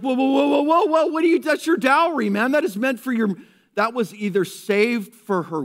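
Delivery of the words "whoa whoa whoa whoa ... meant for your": high, loud alarmed protest; mock outcry. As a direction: cry out to stop her, higher and louder. Critics object at dinner.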